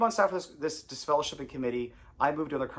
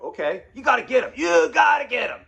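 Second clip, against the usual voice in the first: loud, high shouted demand; yelling; 11 semitones higher, 10 dB louder than usual. Retold or imitated. Angry father demanding punishment, loud shout. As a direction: shout it at him. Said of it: "He's yelling at me".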